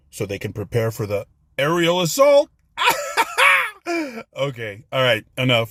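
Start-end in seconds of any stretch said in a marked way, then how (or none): 2.8-4.3 high-pitched cackle at his own joke; high cackling laugh. Real, not acted. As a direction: crack up at your own punchline.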